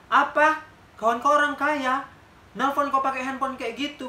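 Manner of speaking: mock her claim in loud disbelief; incredulous loud retort mocking a beggar's rich friend; scornful disbelief.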